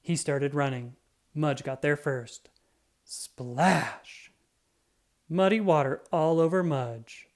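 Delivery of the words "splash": splash effect. A narrator's reading. Splash in puddle.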